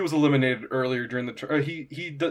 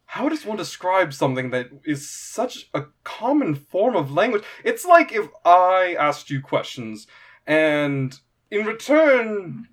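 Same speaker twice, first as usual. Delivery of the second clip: higher voice, slowing to explain; puzzled eager interviewer; 5 semitones higher, 3 dB louder than usual. Acted. Quintus explains to the fighter what an interview is, puzzled. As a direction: spell it out carefully, a bit baffled. Game master plays Quintus.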